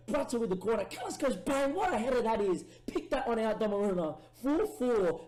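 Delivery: high, loud replay call; excited commentary. Real, not acted. Gamer calling his equaliser to the keeper, excited. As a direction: relish the header all over again.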